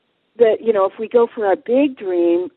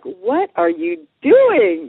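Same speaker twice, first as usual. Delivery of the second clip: high, loud, very drawn-out question; acted-out mounting unease at a stalker; nervous disbelief; 7 semitones higher, 5 dB louder, much slower than usual. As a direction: stretch each word in mock alarm. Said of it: "she's starting to, like, get a little nervous".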